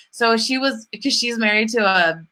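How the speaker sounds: normal talking voice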